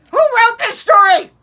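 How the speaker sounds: incredulous yelling question; exasperated outburst. Real, not acted. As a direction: throw up your hands at the story. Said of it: "exasperated reader outburst".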